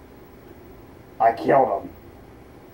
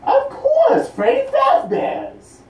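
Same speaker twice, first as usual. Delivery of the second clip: high, slow excited shout; slower than usual. Acted. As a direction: announce it with glee. Excited job news.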